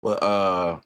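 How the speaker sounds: normal talking voice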